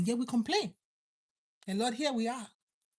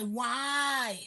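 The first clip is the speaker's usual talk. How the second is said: put-on 1 baby crying; 3 semitones higher than usual. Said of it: "The baby cry".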